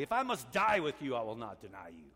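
emphatic imitation of a disciple's vow. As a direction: swear it emphatically, hitting the opening hard. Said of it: "He said it emphatically".

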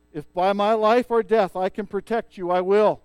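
firm pledge. Retold or imitated. Aragorn pledging his protection.

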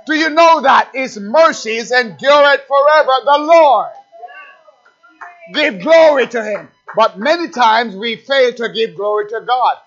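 loud call-and-response preaching; fiery preacher calling out; preaching at full force. Acted. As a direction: build the rhythm, shout the answer. Preaching climax.

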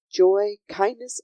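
ordinary delivery, nothing marked

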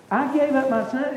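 God hurt that he is doubted; offended God. Acted. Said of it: "I can see God be offended".

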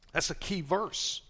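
ordinary talk

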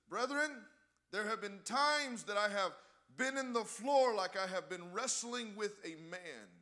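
loud, high, slow proclamation; quoted elder preaching. Retold or imitated. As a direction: quote the elder in full preaching voice, stretching the words. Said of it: "He said, he said".

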